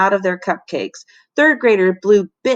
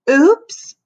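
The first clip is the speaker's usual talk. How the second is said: surprised oops; 7 semitones higher, 6 dB louder than usual. A narrator's reading. Librarian falling into his arms.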